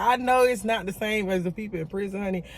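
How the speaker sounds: ordinary talk, nothing marked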